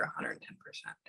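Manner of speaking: barely voiced, very soft murmur; whispered aside. Real, not acted. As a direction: whisper it to yourself. Muttering a received message to herself.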